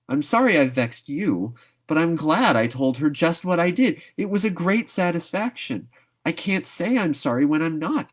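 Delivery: put-on high, fervent girl's protest; impassioned young girl. Anne refusing to apologise, high and fervent. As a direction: pour out the stubborn protest, pitched high.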